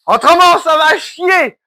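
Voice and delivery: slow, drawn-out joking threat, shouted; mock threatening shout. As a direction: pretend to threaten the subscriber, dragging it out. Menace pour rire à l'abonné. (menace pour rire, lente et criée)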